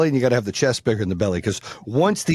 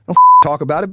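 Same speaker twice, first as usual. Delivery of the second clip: angry challenge; 5 semitones higher, 9 dB louder than usual. Retold or imitated. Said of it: "I was like".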